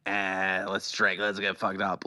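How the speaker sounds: low, soft, offhand shrug; listless, dropped-down self-quote; bored. Retold or imitated. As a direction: mumble an idle suggestion out of boredom. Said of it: "I just am bored and I'm like".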